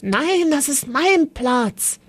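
childish protest, lightly voiced; indignant boy. A narrator's reading. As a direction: Elias snapping back over his spot. Elias claims his seat, arguing.

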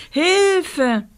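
high panicked cries for help; screaming for help. Acted. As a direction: yell it, terrified child. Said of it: "schrie Rosa".

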